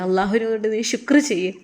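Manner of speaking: ordinary delivery, nothing marked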